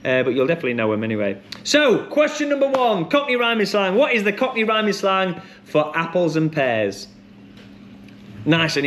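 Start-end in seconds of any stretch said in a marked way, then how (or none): 1.6-7.1 high, ringing host call that steps down; quizmaster announcement. Acted. Host opening the slang round to players, showy quizmaster announcement. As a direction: kick off the question high, like a quiz host. Host launches first question.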